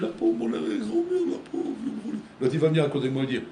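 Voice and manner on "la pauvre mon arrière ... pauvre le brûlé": lamentation aiguë et forcée qui retombe en souffle; faux sanglot. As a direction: pleurnicher pour faire semblant. L'enfant se force à pleurer. (fausse plainte aiguë puis chuchotée)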